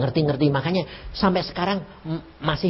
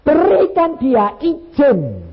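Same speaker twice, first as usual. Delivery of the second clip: much higher, louder and very slow, preaching hard; fervent exhortation; 13 semitones higher, 8 dB louder, much slower than usual. Real, not acted. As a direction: call it out over the congregation. (seruan khotbah yang lantang dan lambat)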